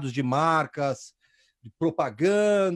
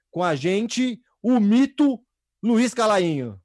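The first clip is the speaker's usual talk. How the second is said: grand entrance announcement; 5 semitones higher, 4 dB louder, slower than usual. Acted. Host introduces second guest.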